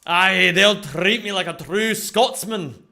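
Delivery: put-on slow, mild Scottish accent; light, slow Scottish accent. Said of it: "do my best impression".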